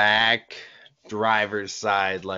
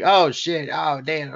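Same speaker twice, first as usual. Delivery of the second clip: surprised; 5 semitones higher than usual. Retold or imitated. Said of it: "we're all like".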